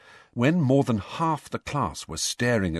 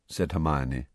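ordinary delivery, nothing marked

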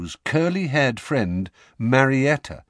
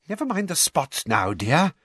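high, impatient dismissal; Umbridge, impatient; 6 semitones higher than usual. A narrator's reading. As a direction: brush it aside with strained sweetness. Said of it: "said Umbridge impatiently".

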